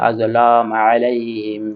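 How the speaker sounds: slow, drawn-out chanted words; slow recitation. Shown as a demonstration. Teacher asking students to read along, slow chant. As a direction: recite slowly for the class to repeat. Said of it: "আপনারা আমার সাথে সাথে পড়ুন".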